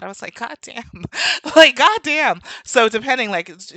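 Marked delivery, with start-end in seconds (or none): 0.3-1.0 soft, higher retold aside; muted disbelief. Reacting to being told of the accent, muted disbelief. 1.6-2.4 loud, high, laughing retort; laughing disbelief. Retold or imitated. Reacting to being told of the accent, loud amused disbelief.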